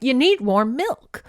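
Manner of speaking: mock doting mom; fussy mothering. [name] pushing milk on Mavis, fussy.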